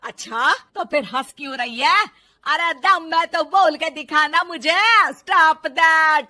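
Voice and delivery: loud, taunting dare; angry goading. Acted. Mother-in-law daring the daughter-in-law to say it, angry. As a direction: push her hard, building to a shout. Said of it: "सास के जोर देने पर".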